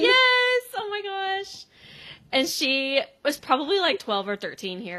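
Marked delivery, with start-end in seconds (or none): 0.0-1.6 a real squeal of delight; excited yes. Real, not acted. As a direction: shout it out, thrilled you knew. Recognizing the actress, excited.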